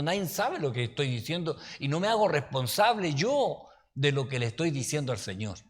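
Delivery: normal talking voice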